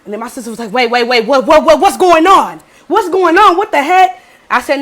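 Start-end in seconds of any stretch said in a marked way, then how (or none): 0.7-4.2 high, loud panicked confusion; flustered, rapid-fire repeats rising louder; bewildered alarm. Retold or imitated. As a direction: imitate a baffled sister breaking in. Sister alarmed by sudden quarrel.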